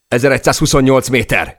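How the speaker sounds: loud, high call-out; shouted altitude report. A narrator's reading. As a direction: shout the reading over the chaos. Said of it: "kiáltotta Per". (hangosan kikiáltott magasságadat)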